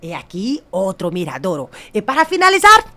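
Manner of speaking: hammed-up tour pitch, building to a loud finish; showy sales pitch. New guide showing tourists the poster, showy pitch. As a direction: present each sight, then belt out the finale.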